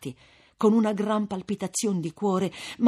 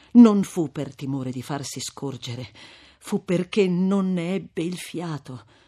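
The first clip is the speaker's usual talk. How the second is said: ordinary delivery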